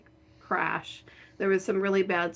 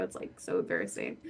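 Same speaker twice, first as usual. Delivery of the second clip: whispered, much lower and softer; embarrassed whisper; 11 semitones lower, 6 dB quieter than usual. Real, not acted. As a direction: let it slip out as a breathless whisper. Embarrassed aside.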